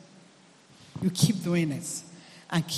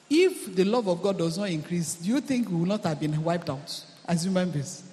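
ordinary talk

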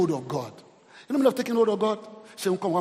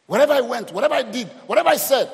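louder and higher, building emphasis; rising preaching; 5 semitones higher, 7 dB louder than usual. Real, not acted. Pastor preaching to the church about being guided, loud build. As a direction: build each phrase like a preacher's climax.

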